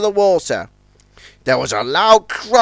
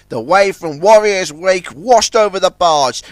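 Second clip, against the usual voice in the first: ordinary delivery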